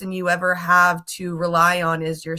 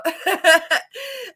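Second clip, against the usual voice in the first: laugh; 11 semitones higher, 5 dB louder than usual. Real, not acted. Laughing at her bad luck.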